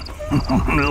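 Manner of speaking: chuckle. Acted. God mocks praying man.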